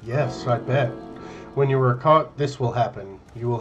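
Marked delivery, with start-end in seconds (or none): none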